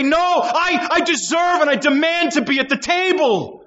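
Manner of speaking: high pitched entitled demand; mock demanding woman. Sermon, voicing what she doesn't say.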